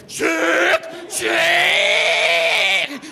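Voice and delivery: high screamed metal vocal; high shrieked rock howl. Retold or imitated. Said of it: "the heavy metal mic check".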